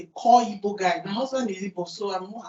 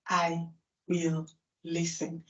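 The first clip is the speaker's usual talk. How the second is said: slow resolve; 3 semitones lower, 5 dB quieter, slower than usual. Shown as a demonstration. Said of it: "the first thing you should tell yourself is".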